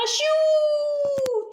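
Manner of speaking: a sharp, high acted sneeze. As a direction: burst out with a big fake sneeze. Character sneezes in story.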